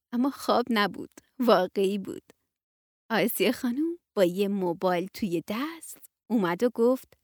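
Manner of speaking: plain reading voice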